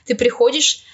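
usual speaking voice

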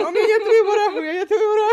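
squealing, high and loud; breathless giddy surrender. Retold or imitated. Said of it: "И ты такой".

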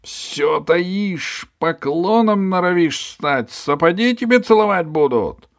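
high, loud shouting tirade; angry boss. Acted. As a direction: berate the cringing ferryman. Hunchback ranting at ferryman. (высоко и громко, злобно)

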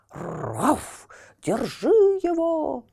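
лающий бульдог. Acted. Police bulldogs barking in chase.

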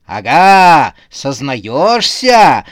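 loud, slow gotcha; triumphant exclamation. A narrator's reading. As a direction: drag out the accusation in triumph. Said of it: "воскликнула Белка-детектив".

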